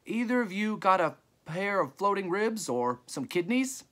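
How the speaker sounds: high-pitched call to distant traders. A narrator's reading. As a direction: ask it across the yard, voice well up. Trader calls across the graveyard.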